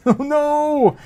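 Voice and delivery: high, rising cry of dismay. Real, not acted. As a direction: caught off guard as it breaks again. Stream fails again.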